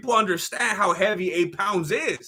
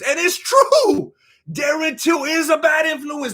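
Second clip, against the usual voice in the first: emphatic shout; 5 semitones higher than usual. Real, not acted. Emphatic agreement.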